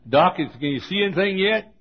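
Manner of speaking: straining to be heard with a tube down his throat; mock shout. Pastor calling out to the doctor from the exam table, half-shouted. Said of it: "Did you ever shout with a battery cable".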